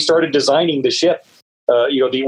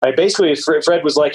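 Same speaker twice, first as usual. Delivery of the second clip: normal talking voice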